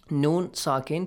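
normal talking voice

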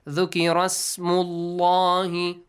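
slow chant gliding from "Dhukira" into "smullahi" without a glottal start; dropped hamzatul wasl. Shown as a demonstration. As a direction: link "Dhukira smullahi", drawing out the long vowel. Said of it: "ignore the hamzatul wasl".

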